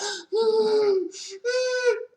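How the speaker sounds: a sudden high, wailing sob-gasp; Monique's crying gasp. Retold or imitated. Retelling her breakdown to viewers, a hammed-up crying gasp. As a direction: gasp like a hysterical movie mother mid-breakdown. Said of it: "I was very much Monique in Precious".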